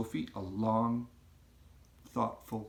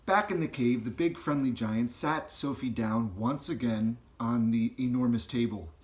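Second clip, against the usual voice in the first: ordinary delivery, nothing marked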